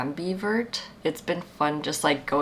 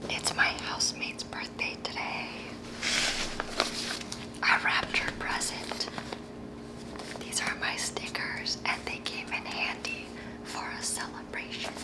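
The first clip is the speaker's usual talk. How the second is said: soft hushed whisper; 8 dB quieter than usual. Real, not acted. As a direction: whisper so no one wakes. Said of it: "whispering beside sleeping housemate".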